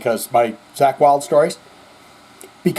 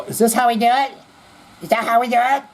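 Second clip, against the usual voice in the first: higher, puzzled mock voice; 8 semitones higher, faster than usual. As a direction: play dumb about the bat, voice pitched up. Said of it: "hold the wrong end of the bat and go".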